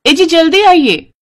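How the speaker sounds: high-pitched, astonished wife's call; excited calling out. Acted. Wife calls husband to see the golden droppings, excited. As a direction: call your husband over in amazement.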